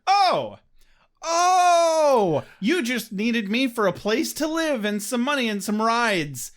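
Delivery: high, loud sarcastic realization; high mock realization. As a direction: squeal it out in mock dawning. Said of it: "you're like".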